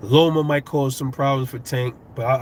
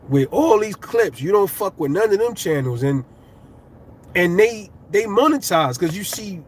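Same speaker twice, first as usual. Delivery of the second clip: exasperated rant at YouTube; 7 semitones higher than usual. Real, not acted. Small creator venting at YouTube over clips, rising exasperated rant. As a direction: voice climbing higher and higher, heated and fed up. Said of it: "I'm like".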